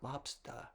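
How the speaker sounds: "lobster" ending in an "ah" with no R; "lobster" with the final R dropped to "ah". Shown as a demonstration. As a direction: say "lobster" the mock Boston way, ending "-stah". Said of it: "spell things without the R".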